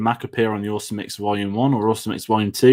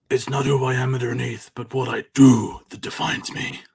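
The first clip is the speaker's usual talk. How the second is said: gruff Batman impression, slowed down. Host quoting Batman for a quiz. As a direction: growl it out like the Begins Batman. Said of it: "It's Batman from Batman Begins".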